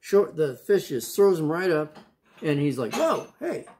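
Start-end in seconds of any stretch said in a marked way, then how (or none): 3.0-3.7 a high, startled yelp of surprise; startled whoa. Acted. Jonah spat out onto the shore by the fish, startled. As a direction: caught off guard as he lands on shore.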